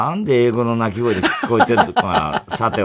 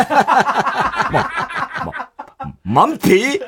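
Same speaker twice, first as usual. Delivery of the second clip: laughing; 10 semitones higher, 3 dB louder, much slower than usual. Acted. Skit, laughing.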